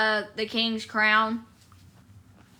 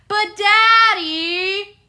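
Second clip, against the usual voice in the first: whiny Pearl; 7 semitones higher, 4 dB louder, much slower than usual. Retold or imitated. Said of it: "Pearl's like".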